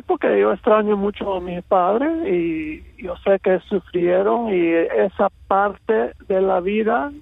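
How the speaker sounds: normal talking voice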